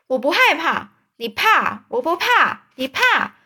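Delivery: put-on high childish tit-for-tat voices; kids' quarrel. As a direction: bicker like two boys. Back-and-forth "afraid" quarrel.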